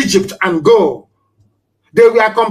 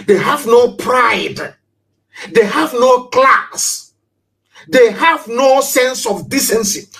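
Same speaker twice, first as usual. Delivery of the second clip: slow, drawn-out emphatic cry; impassioned declaration; 5 semitones higher, slower than usual. Real, not acted. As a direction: lean hard on every word, slowly.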